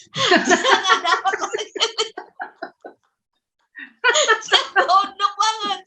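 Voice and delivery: high, drawn-out words through laughter; real helpless giggling on the words; laughing while talking. Real, not acted. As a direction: crack up telling your own story. Laughing at her own story.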